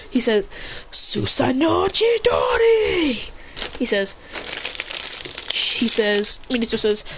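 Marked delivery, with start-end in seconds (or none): 0.9-3.3 high, drawn-out anime shout; slow, rising battle yell; attack call. Acted. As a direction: cry the move name high and stretch the ending. Calling out an attack.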